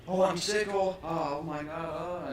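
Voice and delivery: high, loud whiny panic; mock panicked sick person. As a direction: ham up a scared patient. Mocking panicked sick people.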